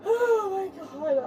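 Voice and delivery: slow, sobbing wail; drawn out through tears; crying. Real, not acted. Woman in police car breaks down, slow sobbing.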